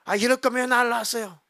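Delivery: child's retold whiny protest; 앙탈 부리며 대들기. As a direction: act a child talking back to a parent. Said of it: "앙탈 부리고 막 부모한테 막 대들고". (부모에게 앙탈 부리듯 따지기)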